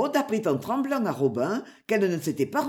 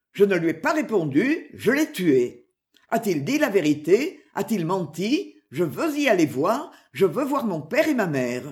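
ordinary delivery, nothing marked

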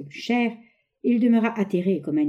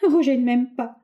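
high, louder voice stammering through tears; stammering through sobs; 7 semitones higher, 5 dB louder than usual. A narrator's reading. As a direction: blurt it out between sobs. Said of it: "balbutia-t-elle entre deux sanglots".

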